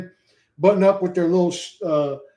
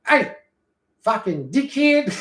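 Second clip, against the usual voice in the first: put-on slow, higher Australian accent with a drawn-out dickhead; Australian accent attempt; 7 semitones higher, slower than usual. Streamer showing chat his Australian accent, slow Aussie drawl. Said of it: "I've been perfecting it".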